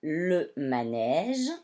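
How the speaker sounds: « manège » très lent, avec le son « j » final; détache « le manège », fais entendre le « j »; G doux appuyé. Shown as a demonstration. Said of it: "vont la faire chanter J". (« manège » articulé lentement, G doux)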